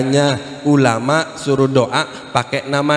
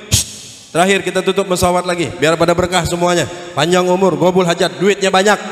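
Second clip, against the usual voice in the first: ordinary talk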